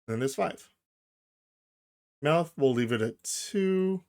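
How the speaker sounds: ordinary talk, nothing marked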